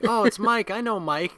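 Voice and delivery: put-on a trusting toddler; imitating child. Boy recognizing Mike, imitated child voice.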